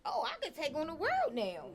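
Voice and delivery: mock triumphant exclamation; pumped up. Listener fired up by a favorite record, pumped up.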